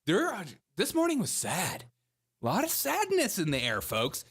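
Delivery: high, swooping mock lament; hammed-up sorrowful newscaster; mock sad. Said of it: "we could all be really sad about".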